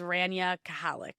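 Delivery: Kahalik with an extra ha syllable, ka-HA-lik; mispronounced name. Shown as a demonstration. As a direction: say Kahalik in three syllables, stress on HA. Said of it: "the craziest way someone's ever said my name".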